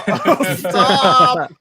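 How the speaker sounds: loud cry of stop through laughter; laughing protest. Real, not acted. Friend reacting to bottoms off joke, laughing protest. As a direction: beg them to stop while cracking up.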